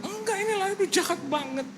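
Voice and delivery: high-pitched mock protest; imitating indignant wife. Preacher mimics wife complaining of the soap villain, high protest. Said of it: "Saya bilang".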